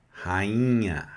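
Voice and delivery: ordinary talk, nothing marked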